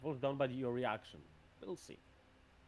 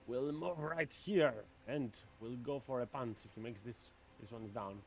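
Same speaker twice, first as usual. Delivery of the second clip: usual speaking voice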